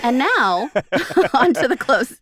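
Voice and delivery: loud, grand announcer read with laughs; mock announcer. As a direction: announce the finale like a host.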